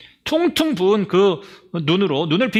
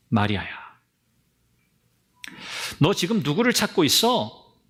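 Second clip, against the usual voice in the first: tender call. Acted. Jesus calling Mary gently.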